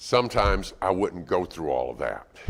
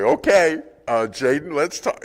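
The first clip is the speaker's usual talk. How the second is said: high, loud attempt at composure; stifling amusement; 8 semitones higher, 8 dB louder than usual. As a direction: act a parent trying not to laugh while disciplining. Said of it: "cover your mouth while you say it".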